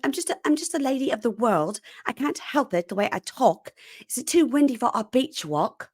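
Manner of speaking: mock British housewife voice; fake British accent. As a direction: play a faux-posh British lady. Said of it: "She's like".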